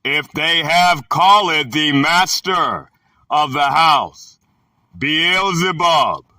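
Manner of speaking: slow, halting phrases with weighty pauses; scripture reading. Acted. Reader giving listeners the words of the Son, solemn scripture reading. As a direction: read it out deliberately, a few words at a time.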